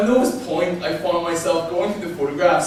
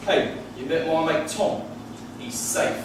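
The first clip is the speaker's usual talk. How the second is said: lightly imitated English accent; casual English mate vouching for a friend; easygoing line in a put-on English accent; 3 semitones lower, 4 dB quieter, slower than usual. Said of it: "I have English friends and they'll say".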